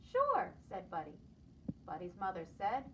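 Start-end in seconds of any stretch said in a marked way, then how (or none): 0.1-0.5 very high, loud eager reply; cheerful sure. Acted. Buddy eagerly agreeing to mother, very high and bright. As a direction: chirp it happily.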